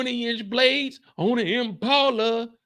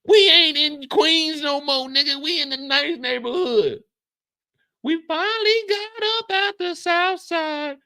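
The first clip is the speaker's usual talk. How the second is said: high scolding; 7 semitones higher, 3 dB louder than usual. Acted. Neighbor scolding Lou.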